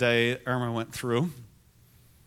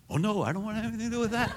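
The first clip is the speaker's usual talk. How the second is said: mock panic; 7 semitones higher, much faster than usual. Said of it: "Putting a microphone and saying".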